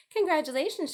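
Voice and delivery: high, loud cheer; shouted. A narrator's reading. Wilbur shouts congratulations to the goose, loud cheer. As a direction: call it out gladly. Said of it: "shouted Wilbur".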